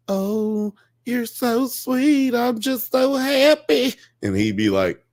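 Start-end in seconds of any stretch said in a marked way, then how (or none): 0.1-3.9 high, slow, sugary falsetto gush; mock swooning woman. Mocking the woman on the tape, high swooning voice. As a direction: gush it like a lovestruck girlfriend. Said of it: "she's like".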